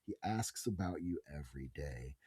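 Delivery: very soft, low, eerie delivery; eerie hushed mother. Retold or imitated. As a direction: hush it like a creepy mother. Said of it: "And she says".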